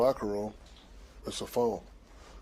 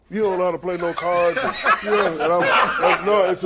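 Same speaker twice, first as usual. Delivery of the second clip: loud, raised-pitch mock accusation; mock quarrel; 5 semitones higher, 9 dB louder than usual. Imitating two moms squabbling over cards, mock quarrel. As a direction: imitate a card-table squabble at volume.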